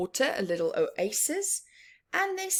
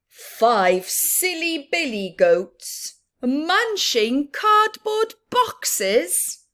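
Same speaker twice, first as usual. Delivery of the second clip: slow, rising up very high on cardboard boxes; silly read-aloud; 5 semitones higher, 4 dB louder, slower than usual. Acted. Teacher reading the silly goats page, slow and climbing high. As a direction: make it sillier as you go, up high.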